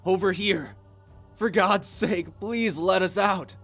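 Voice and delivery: high, loud, slow desperate pleas; desperate begging. Acted. Sobbing man begging the guard for release, desperate. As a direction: beg to be let out.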